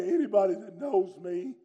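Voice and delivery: ordinary delivery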